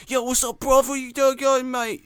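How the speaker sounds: high, brash Cockney bloke greeting; Cockney accent. Retold or imitated. Imitating the hitman's voice actor greeting the player, Cockney. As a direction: do a mock Cockney townie.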